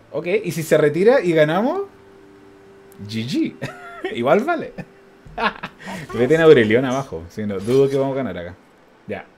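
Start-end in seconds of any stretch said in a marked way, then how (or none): 3.6-4.1 brief high, soft giggle; quick, quiet high-pitched chuckle. Real, not acted. Amused by the possible win. 5.4-6.1 soft breathy chuckle; short airy laugh, softly. Real, not acted. Amused by the possible win.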